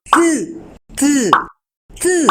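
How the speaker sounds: "T" as a bare voiceless tap of the tongue, no vowel after it; just the tongue tap of "T", never "te"; bare T sound. Shown as a demonstration. Teacher showing children the bare T sound, no vowel. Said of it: "solo hacemos el intento".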